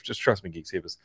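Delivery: hushed aside to the listeners; whisper. Real, not acted. Reassuring the Geek Savers audience in a soft whisper. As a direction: lean in and whisper it.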